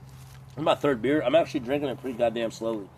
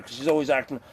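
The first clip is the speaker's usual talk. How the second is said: normal talking voice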